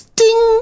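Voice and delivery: high bright bell ding; correct answer ding. Retold or imitated. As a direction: sing the stop bell ding. Imitating stop bell.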